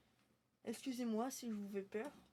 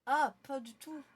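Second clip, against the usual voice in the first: surprised shout; 5 semitones higher, 14 dB louder than usual. Real, not acted. Sudden shout during game.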